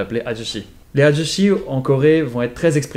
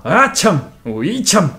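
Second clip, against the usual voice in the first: louder, higher exclamation of disbelief; expressive ajoshi; 6 semitones higher, 4 dB louder, slower than usual. Shown as a demonstration. Teacher imitates what the series says often, expressive ajoshi outburst. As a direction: exclaim like the Korean uncle of the series. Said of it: "dans la série, tu l'entends très".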